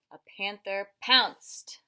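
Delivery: loud, slow storybook pounce; dramatic pounce. Acted. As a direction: make the pounce land hard. Dramatic book reading.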